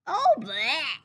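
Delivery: put-on loud disgusted bleat, child voice. A narrator's reading. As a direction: spit out a grossed-out bleh. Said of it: "bleated Chester".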